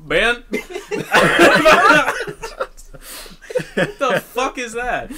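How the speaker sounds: high laughing disbelief; helpless laughter at the memory. Real, not acted. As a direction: laugh out the words.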